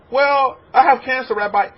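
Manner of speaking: complaining congregant. Retold or imitated. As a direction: whine your trouble to the rabbi.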